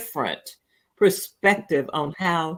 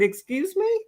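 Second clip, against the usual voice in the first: much higher, incredulous question; incredulous excuse me; 12 semitones higher than usual. Retold or imitated. Answering the strange man in the store, high and incredulous. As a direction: pitch it up in disbelief. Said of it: "I said".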